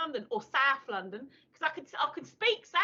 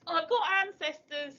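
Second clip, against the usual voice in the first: ordinary talk, nothing marked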